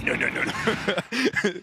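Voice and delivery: put-on slow, drawn-out French accent. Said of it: "in a very French accent".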